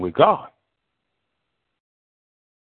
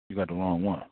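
softer, lower, letting it land; quiet repeat; 6 semitones lower, 8 dB quieter than usual. Real, not acted. Preacher repeats the warning softly to the congregation. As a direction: drop the voice for emphasis.

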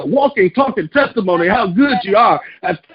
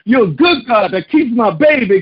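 ordinary talk, nothing marked

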